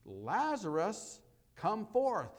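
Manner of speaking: preacher booming Jesus' call at the grave; loud cry of command. Retold or imitated. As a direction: call a dead man out of the tomb. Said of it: "that cried out".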